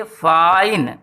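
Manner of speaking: Fine held long with a falling end; stressed, drawn-out syllable. Shown as a demonstration. Teacher repeating the stressed part for pupils, long drawn fine. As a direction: lean on fine and stretch it. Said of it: "खींच के कहना पड़ रहा है".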